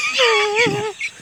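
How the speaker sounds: wheezy snickering cartoon dog laugh; Muttley wheeze laugh. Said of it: "the wacky races laugh".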